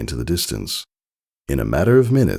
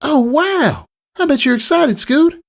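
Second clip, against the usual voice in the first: high excited filly voice; 5 dB louder than usual. A narrator's reading. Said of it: "Apple Bloom said".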